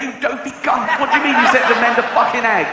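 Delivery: high, fast exasperated rant; berating an idiot soldier with disbelief; angry officer. Acted. Officer yelling at the soldier who sent the horses away, exasperated.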